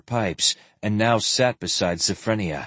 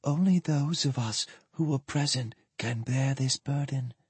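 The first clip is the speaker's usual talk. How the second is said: near whisper; 2 semitones higher, 8 dB quieter than usual. A narrator's reading. Ailing woman answers for the girl, hardly above a whisper. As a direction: barely get the words out, weakly. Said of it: "hardly more than a whisper".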